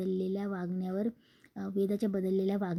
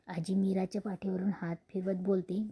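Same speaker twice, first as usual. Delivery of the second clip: ordinary delivery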